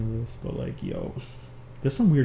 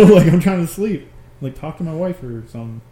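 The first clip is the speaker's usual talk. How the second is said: normal talking voice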